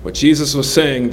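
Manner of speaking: normal talking voice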